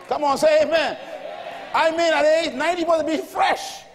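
loud, rising sermon shouting; fired-up call to the congregation; preaching at full force. Acted. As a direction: preach it to the rafters.

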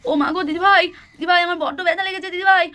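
acted wail of pain; hurt child wailing. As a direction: plead for help as a hurt little one. Hurt child wails to elder sibling.

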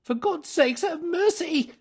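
high, desperate shriek; terrified, high-pitched pleading; shrieked plea. A narrator's reading. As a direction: beg for mercy in a high panicked cry. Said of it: "he shrieked".

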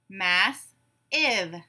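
"Mass-ive" slowly split in two; syllable by syllable. Shown as a demonstration. Class breaks the word apart together, syllable by syllable. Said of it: "break it apart into syllables".

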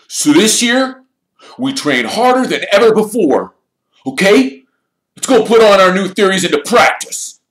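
loud, high captain's pep talk; forceful, high-pitched rallying cry; rallying shout. A narrator's reading. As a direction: shout the team out to practice. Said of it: "Wood shouted".

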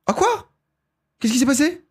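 very high-pitched surprised questions; startled. Real, not acted. Streamer startled by a bug in the game, high surprised voice. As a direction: squeak it up high, caught off guard.

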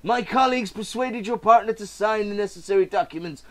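spring the trap with a smile; villain gloating over a swindled seller; smug villain's reveal. Acted. Voices seller and villain buyer.

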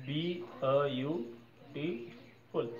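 beautiful drawn out very slowly, syllable by syllable; slow syllable breakdown. Shown as a demonstration. Teacher counting syllables for students, very slow drawn-out beautiful. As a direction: stretch out beautiful so each syllable can be counted. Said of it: "तो इसमें चार syllable है".

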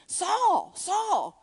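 calling a name, lifted and falling; Jesus calling out. Retold or imitated. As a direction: call him by name, high then dropping. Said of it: "Jesus speaks to him, and he said".

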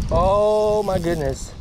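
ordinary delivery

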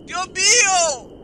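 slow, loud, wailing fake cry; mock terror. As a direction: ham up the horror. Reacción exagerada de miedo. (terror exagerado llorando)

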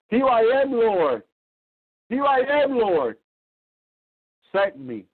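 fervent cry. Acted. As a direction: call out to heaven, offering yourself. Voicing Jesus' answer to God.

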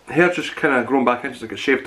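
crisp at lips between words; talking while chewing. Real, not acted. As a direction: mouth partly full of crisp. Seen 0: Bites crisp at 3.9 and 7.1; holds crisp near mouth between.

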